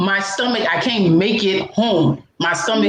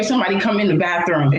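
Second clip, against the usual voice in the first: normal talking voice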